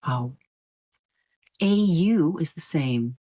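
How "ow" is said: ow mit Gleitlaut; ow gleitend vorgeführt als Fehler; wrong ow sound. Shown as a demonstration. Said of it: "you don't say ow".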